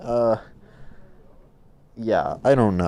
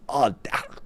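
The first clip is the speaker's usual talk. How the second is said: usual speaking voice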